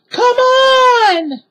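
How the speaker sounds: very high, loud distant call; shouting. A narrator's reading. Sister shouts from treehouse window, loud call. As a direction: shout down from above. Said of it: "Annie was shouting from the treehouse window".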